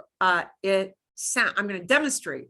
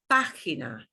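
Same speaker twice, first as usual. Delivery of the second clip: Página, first syllable punched; stress on PÁ; 6 semitones lower than usual. Shown as a demonstration. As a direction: hit the pá in Página. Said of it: "we punch that syllable harder".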